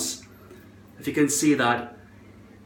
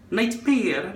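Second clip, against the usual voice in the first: higher-pitched botched Scottish NIGHTMARE; failed Scottish try; 5 semitones higher than usual. Shown as a demonstration. Vlogger retrying the word, high wrong Scottish "Nightmare". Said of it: "That's not Scottish accent there".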